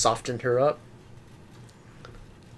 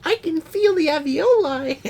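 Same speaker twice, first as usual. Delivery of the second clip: high mock voice; 5 dB louder than usual. Joking creepy line, high.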